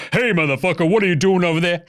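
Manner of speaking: put-on trash-talking player voice; Karl Anthony Towns's first voice. Host imitates player calling out a rival, put-on voice. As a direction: challenge someone in a put-on player's voice. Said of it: "Karl Anthony Towns is like".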